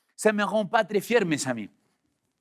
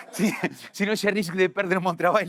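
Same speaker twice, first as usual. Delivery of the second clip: amused, breaking into laughter; laughing. Real, not acted. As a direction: laugh through the admission. Jokes about his own confession. (en riant)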